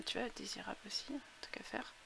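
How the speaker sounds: soft whispered aside; whispering. Real, not acted. Aside under breath.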